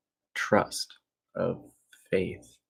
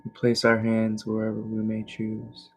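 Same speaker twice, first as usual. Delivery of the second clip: guided meditation; 3 semitones higher than usual. Real, not acted. Teacher shifting attention to navel and breath, calm meditation voice. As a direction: guide them calmly through each breath. Leads evening relaxation meditation.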